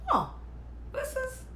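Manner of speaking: high, soft mock amazement; imitated surprise. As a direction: mimic someone mesmerized, voice small and lifted. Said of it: "surprised, even mesmerized".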